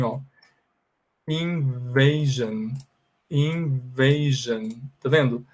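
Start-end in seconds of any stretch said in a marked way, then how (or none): 1.3-4.7 "Invasion" very slow, in-VAY-zhun; slow pronunciation demo. Shown as a demonstration. Teacher showing the class how to say invasion, slow demo. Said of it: "então fala assim".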